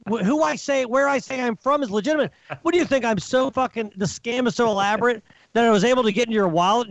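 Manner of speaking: high-pitched exasperated rant at the accusers; furious rant. Real, not acted. Venting at scammer accusers.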